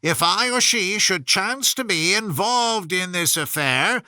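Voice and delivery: lightly sing-song verse recital; a touch of formal verse reading; poem reading. Acted. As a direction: read the rhymes out with a gentle lilt.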